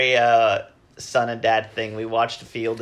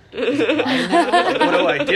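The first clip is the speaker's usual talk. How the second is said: a comic anguished inner voice; mock agonizing; 12 semitones higher, 6 dB louder than usual. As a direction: act out the dilemma in a hammed-up voice. Retelling inner dilemma over book.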